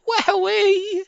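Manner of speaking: a cub's delighted shriek. A narrator's reading. Small fox shrieking after the fiery cider. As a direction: shriek it high and long.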